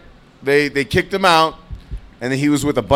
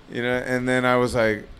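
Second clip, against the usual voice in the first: usual speaking voice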